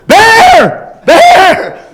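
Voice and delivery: high, loud Southern mom hollering; mom's loud yell. Retold or imitated. Mom calling her son across the house, loud holler. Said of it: "She's very loud".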